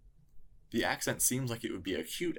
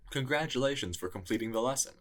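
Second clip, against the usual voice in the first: ordinary delivery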